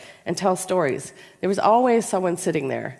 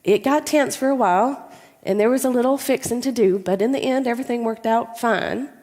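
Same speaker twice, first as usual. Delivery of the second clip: higher-pitched imitation of grandmother wrapping up her tale; Bessie; 5 semitones higher than usual. Granddaughter imitates grandmother ending her story, high and easy. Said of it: "my grandmother ends the story by saying".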